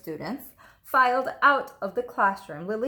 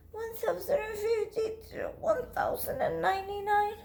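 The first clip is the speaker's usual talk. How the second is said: tearful counting; 11 semitones higher, 4 dB quieter than usual. Acted. Lily counting while sulking.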